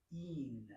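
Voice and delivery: "een" as one long ee closed by n; a lone, careful "een"; demonstrated pronunciation. Shown as a demonstration. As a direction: model "een" softly for a learner. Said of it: "this is pronounced".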